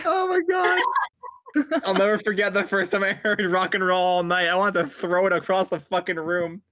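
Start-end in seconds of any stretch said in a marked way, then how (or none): 0.0-0.8 high laughing cry; laughing shriek. Real, not acted. Laughing outburst.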